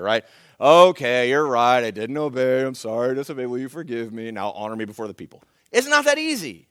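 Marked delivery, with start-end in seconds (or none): 0.6-5.4 1 perfunctory apology. Retold or imitated. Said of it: "what you get from Saul here".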